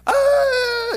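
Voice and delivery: high loud mock scream "ah"; child's scream. Children scared going down the first hill: scream. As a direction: scream like a kid on the first drop. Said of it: "the children are like".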